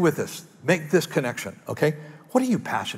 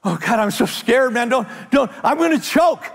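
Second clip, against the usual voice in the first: much higher, panicky wail; mock terror; 8 semitones higher than usual. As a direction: squeal like you're about to choke. Imagined scared disciple.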